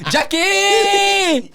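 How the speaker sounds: very high, drawn-out call; mock high call. Calling out in mock film impression.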